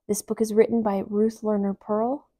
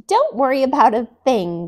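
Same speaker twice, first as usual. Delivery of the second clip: louder, lifted whoop; 3 semitones higher, 7 dB louder than usual. A narrator's reading. Emmy whooping to her shy friends, loud. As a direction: whoop it out reassuringly. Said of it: "whooped Emmy".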